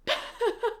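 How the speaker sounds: a choked half-laugh breaking through tears; tearful laugh-sob. Real, not acted. Reader overcome by a sad scene, tearful laugh. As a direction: let a teary laugh crack out. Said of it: "I can't".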